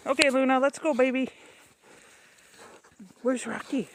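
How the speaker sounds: high coaxing dog-talk voice. Real, not acted. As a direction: sweet-talk the dogs along. Calling to the dogs.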